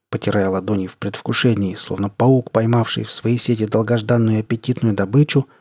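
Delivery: plain reading voice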